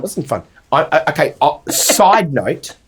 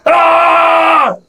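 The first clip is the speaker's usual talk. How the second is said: loud, sustained mock yell; high held scream, copying someone; imitated scream; 12 semitones higher, 5 dB louder than usual. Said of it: "And then Luke's like".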